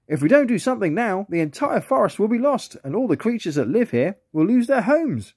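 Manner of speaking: high, mildly sad otter voice; a small otter fearing for his home. A narrator's reading. Otter telling friends the forest will be lost, mildly sad. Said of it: "said Ollie sadly".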